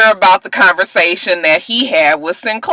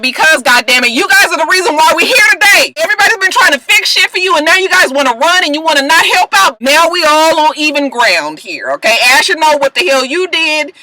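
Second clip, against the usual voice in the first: high, heated, rapid-fire telling-off; imitated angry tirade; acted scolding; 7 semitones higher than usual. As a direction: berate them in mock exasperation. Acting out Annalise berating the kids.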